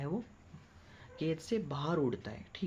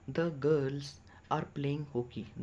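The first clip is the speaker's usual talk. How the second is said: slow dictation; much slower than usual. Shown as a demonstration. Teacher dictates slowly.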